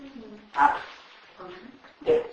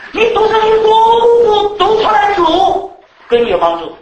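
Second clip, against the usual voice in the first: high and loud, piling up the repeats; preaching at full force; 11 semitones higher, 11 dB louder than usual. Acted. Preacher telling the church how to read scripture, loud building. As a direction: build the repetition to a peak.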